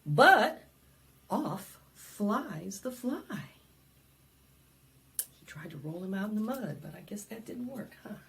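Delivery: usual speaking voice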